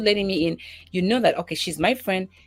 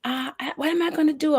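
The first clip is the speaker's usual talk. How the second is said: panicky daughter; 10 semitones higher than usual. Retold or imitated. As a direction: imitate a flustered kid who's locked out. Daughter voiced, panicking over keys.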